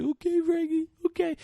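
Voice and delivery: high-pitched mock whimper; scared compliance. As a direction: give in meekly, way up high. Retells frightened victim giving in.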